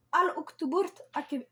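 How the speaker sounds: slow, careful name with an Arabic accent; sounding out a dish name with an Arabic accent. Real, not acted. Presenting a recipe, says the name with an Arabic accent. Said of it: "je suis désolée pour mon accent arabe".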